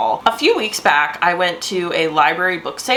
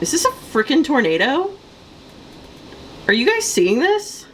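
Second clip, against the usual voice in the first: alarmed disbelief; 7 semitones higher than usual. Real, not acted. Tornado-like storm outside.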